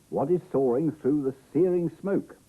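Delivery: high, loud suspenseful question; dramatic story reading. A narrator's reading. Reader asks what flies over the volcano, dramatic and loud. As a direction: project it big, with rising excitement.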